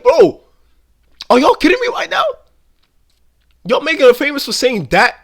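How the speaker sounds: high, loud disbelief; incredulous outburst. Retold or imitated. Reacting to Hawk Tuah merch, loudly incredulous. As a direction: cry out like you can't believe it.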